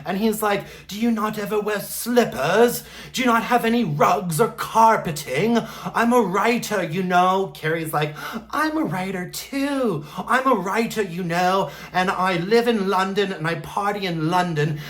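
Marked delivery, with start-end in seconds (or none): 0.9-7.5 put-on higher-pitched, indignant old British neighbour; crotchety British man. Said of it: "this crotchety old British man". 8.5-10.0 high-pitched mock retort; mimicked Carrie. Voices Carrie in acted quarrel.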